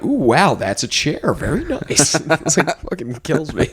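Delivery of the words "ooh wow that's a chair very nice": softer, wowed mock-American accent; mock American impression. Americans admiring a chair in the airport, mock American accent. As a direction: marvel like an American, as an Australian mimics it. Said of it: "like, the American impersonation".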